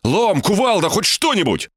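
high-pitched pleading list; urgent outcry. A narrator's reading. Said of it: "выкрикнул я". (отчаянный выкрик повыше)

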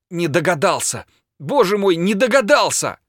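building to a high, pained exclamation; anguished self-reproach; anguished regret. A narrator's reading. Bitten man's anguished exclamation.

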